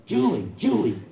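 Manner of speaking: loud high yell calling his wife. Retold or imitated. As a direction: bellow her name across the car. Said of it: "the biker yells out".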